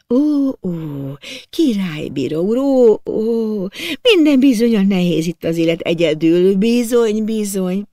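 sympathize like a tender-hearted widow; sighing sympathy. A narrator's reading. Widow hearing out his complaints, sighing sympathetically. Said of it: "Helén asszony nagyokat sóhajtva hallgatta".

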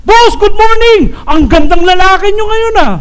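loud, high gushing flattery; sucking up. Acted. Employee buttering up the boss before bonus, gushing. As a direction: lay it on thick, loud and high. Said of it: "sisip-sip ka na sa boss mo".

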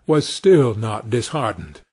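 ordinary delivery

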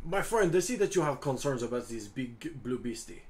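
put-on French-accented adventurer, courteous and expansive; [name] in character. [name] asks a friend about the blue dragon, in character. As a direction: play a courtly Frenchman addressing a companion.

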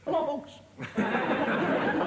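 mock variety-show hello; Eric Morgan greeting. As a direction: greet the audience like the comedian, laughing through it. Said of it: "that's Eric Morgan, isn't it?".

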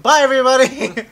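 loud, high-pitched farewell call; shouted sign-off. Pretend. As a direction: call goodbye out to the whole audience. Calls sign-off to viewers.

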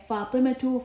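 فاطمة said fatimatu, final tu; voweled t ending. Shown as a demonstration. Teacher showing the spoken t, فاطمة ending in tu. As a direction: end فاطمة on a clear tu. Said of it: "هنا لفظتها تاء".

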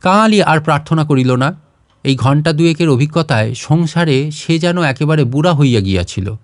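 plain reading voice

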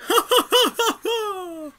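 high squeaky gloating villain voice; cackling villain. Acted. Streamer voicing Pokey gloating at Ness, in a high squeaky villain voice. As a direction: gloat like a cartoon baddie making off.

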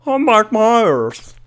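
a bad Scottish Mike Myers impression, drawn out; bad Mike Myers Scottish accent. Gamer mocking Shrek's voice actor, bad Scottish accent. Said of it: "the worst Mike Myers accent".

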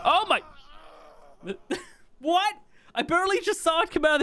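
a sudden high yelp of shock, then a laugh; real shock breaking into laughter; startled surprise. Real, not acted. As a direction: caught off guard, blurting out. Giant serpent suddenly kills him.

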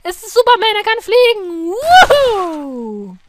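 loud, high excited cry; excited shout. Real, not acted.

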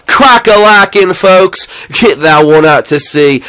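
high, loud, stretched-out announcer call; home-run call. Real, not acted. Gamer to viewers after hitting a homer, triumphant call. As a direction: belt it out slowly like a ballpark call.

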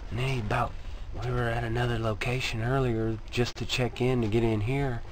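low, hushed nervous talk, kept under his breath; nervous hushed griping; hushed venting. Real, not acted. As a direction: keep it down so the trainer won't hear. Venting secretly near trainer.

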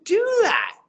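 high, astonished asking; amazed disbelief. Retold or imitated. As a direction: marvel at it, pitched far up. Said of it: "I'm like".

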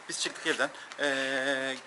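ordinary talk, nothing marked

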